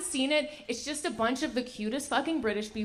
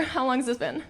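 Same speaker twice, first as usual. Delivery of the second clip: ordinary talk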